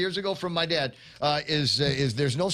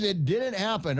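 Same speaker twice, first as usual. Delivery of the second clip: didn't punched high, falling off; stress on didn't; 6 semitones higher than usual. Shown as a demonstration. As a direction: hit "didn't" hard and bring it down. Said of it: "without pictures".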